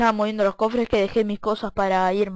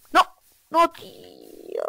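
long drawn-out high "no"; dismayed cry; 8 semitones higher, much slower than usual. Real, not acted. Falling into lava, dismay. (grito de desesperación)

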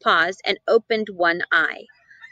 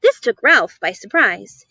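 ordinary delivery

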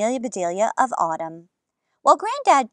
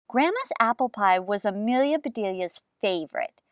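ordinary delivery, nothing marked

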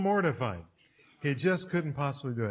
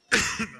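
sharp startled intake of breath; shocked gasp; 5 semitones higher, 6 dB louder than usual. Retold or imitated. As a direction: gasp as if the room froze. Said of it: "as everybody went".

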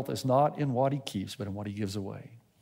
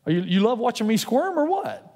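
mock complaint; 8 semitones higher, 3 dB louder than usual. Complaining to God.

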